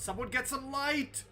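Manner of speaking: high, loud call for light; shout. Acted. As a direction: shout out to the party. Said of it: "as Kavak shouts".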